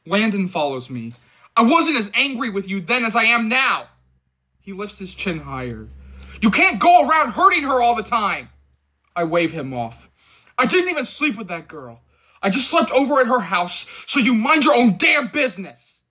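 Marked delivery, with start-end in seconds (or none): 1.6-3.9 angry. A narrator's reading. Angry confrontation. 6.4-8.5 loud, high scolding; angry shout. A narrator's reading. Angry confrontation. 10.6-16.0 angry. A narrator's reading. Angry confrontation.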